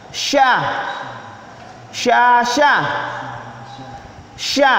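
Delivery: "Sya" hushed and spread; "Sya" as Arabic shin. Shown as a demonstration. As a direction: say "Sya" with a wide sh. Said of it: "ada titiknya tiga".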